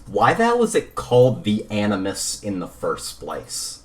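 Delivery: ordinary talk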